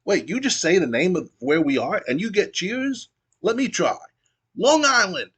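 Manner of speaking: mock heel shouting for cheers; Kanyon's crowd pop attempt. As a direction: try to pop the crowd, yelling it out. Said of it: "Kanyon goes".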